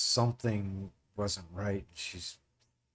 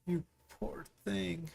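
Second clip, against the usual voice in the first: pitying woman; 7 semitones higher, 6 dB quieter, slower than usual. Acted. GM voices pitying NPC woman.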